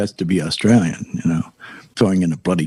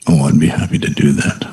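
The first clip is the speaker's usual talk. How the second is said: bedroom voice; 7 semitones lower than usual. Pretend. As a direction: put on a mock bedroom voice. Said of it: "put on bedroom voice for that".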